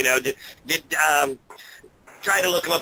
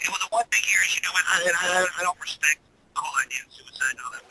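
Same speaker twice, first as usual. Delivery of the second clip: tin can sound; 3 semitones higher, faster than usual. Real, not acted. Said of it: "You sound like you're in a tin can".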